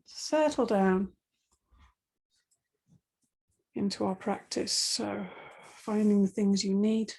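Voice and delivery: calm, quiet relaxation guide; guided meditation. Acted. Settles class into Yoga Nidra.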